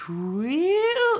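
long, very high, drawn-out squeal; high-pitched squeal. Shown as a demonstration. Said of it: "like, really kind of high pitchy thing".

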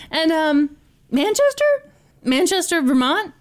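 high, rising fake confusion; feigned ignorance. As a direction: play dumb about the town.

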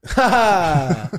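loud, high imitated "ha ha"; mock laugh. Imitating the visitor at the door, mock laugh. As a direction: burst out with a loud fake laugh. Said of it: "He's like".

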